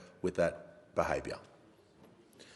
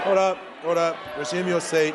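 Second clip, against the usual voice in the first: loud stern call to order; shouting order order; 10 semitones higher, 6 dB louder than usual. Real, not acted. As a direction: bark it over the noise. Speaker calling chamber to order.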